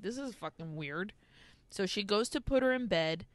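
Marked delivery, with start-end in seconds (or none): 0.0-1.1 muttered aside. Retold or imitated. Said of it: "And she's like".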